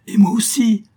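high, loud burst of delight; young count thrilled to share the campaign, joyful shout; joyful exclamation. A narrator's reading. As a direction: cry it out in pure joy. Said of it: "avec un transport de joie".

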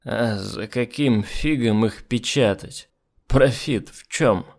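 put-on slow drunk slur; words barely turning, drunk; slurred drunk speech. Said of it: "еле ворочая языком".

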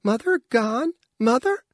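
very high, plaintive little voice; small child whimpering rising questions. A narrator's reading. Child asking after missing mother, high whimper. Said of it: "the Scrap whimpered".

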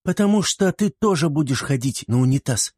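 put-on high-pitched girl's retort; pushy high girlish voice; insistent little girl. A narrator's reading. Girl answers boy back in argument.

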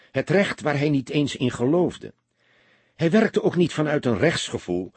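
ordinary delivery

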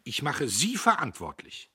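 indignant threat, pitched high; angry accusation. A narrator's reading. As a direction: throw the blame at him loudly. Boss blames foreman in quarrel.

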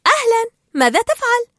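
high, loud hello; surprised greeting. A narrator's reading. Dory forgetfully meets Marlin again, high surprised greeting. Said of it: "لكنها تفاجأت لرؤيته وقالت".

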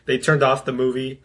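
ordinary talk

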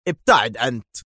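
firm, controlled command; curt, firm dismissal; stern. A narrator's reading. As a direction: order it sternly without raising pitch. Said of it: "في صرامة".

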